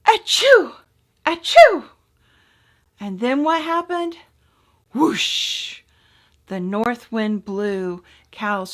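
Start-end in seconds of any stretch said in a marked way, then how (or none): 0.1-1.8 two high, loud little sneezes; tiny sneeze. Acted. Cold baby mouse sneezing in the wind, high and loud. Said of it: "the mouseling sneezed". 4.9-5.8 whoosh. A narrator's reading. Wind blows bed apart.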